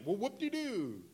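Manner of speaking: high, drawn-out sarcastic whoop; hammed-up fake celebration; mocking. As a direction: pretend to be thrilled, mockingly. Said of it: "he's mocking you".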